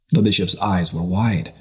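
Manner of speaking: ordinary delivery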